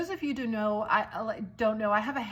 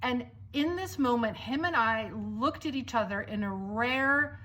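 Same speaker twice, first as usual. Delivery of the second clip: ordinary talk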